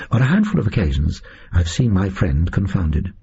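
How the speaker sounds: ordinary delivery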